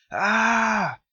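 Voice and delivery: sharp high shriek of alarm; a sudden piercing "Ah!"; a wife's frenzied shriek at her husband; frenzied cry. A narrator's reading. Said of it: "she cried out in a frenzy".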